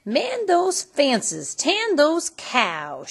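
loud, slow, high barked orders; stepmother yelling. A narrator's reading. Stepmother yelling orders at Cindy, loud and slow. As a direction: bark the chores at her like a tyrant. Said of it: "she would yell".

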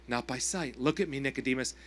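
Jesus speaking. Acted. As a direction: speak as a gentle, steady Jesus. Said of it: "Jesus says to him".

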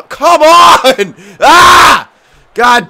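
loud, high cry of frustration; frustrated shout. Real, not acted. Gamer losing the puck, shouting at the game. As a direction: bellow it at the screen.

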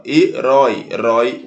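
"Herói" split, then the "rói" isolated; stress on last syllable. Shown as a demonstration. Teacher shows the last example, stressed ending of "Herói". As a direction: lean on "rói" in "Herói" and repeat it. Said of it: "última sílaba".

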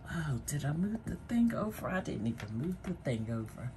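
hushed quick muttering to herself; whispered aside. Real, not acted. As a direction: drop to a fast whisper, off mic. Muttered aside about the wheel.

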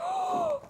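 sharp high gasp of delight; excited gasp. Real, not acted. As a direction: gasp at the surprise. Seeing baby inside doll.

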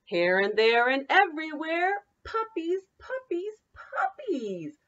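lively singsong picture-book read; bouncy read-aloud for children. Acted. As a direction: read it like story time for little kids. Teacher reads picture book aloud.

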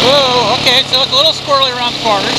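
talking inside a helmet over wind. Real, not acted. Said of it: "I'm gonna put my helmet on".

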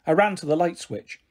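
ordinary delivery

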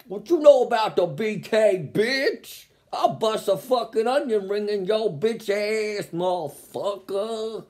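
mock gangster snarl. Imagining the commenter eating hard like a gangster, mock tough threat. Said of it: "hard like a gangster".

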